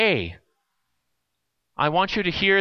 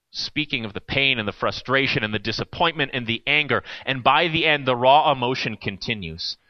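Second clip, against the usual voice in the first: normal talking voice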